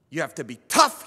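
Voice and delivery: loud, high tough-guy command; a stern grandfather drilling toughness into the boy, loud and commanding; grandfather's stern order. Retold or imitated. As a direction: bark it like a hard old grandfather. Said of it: "he would say".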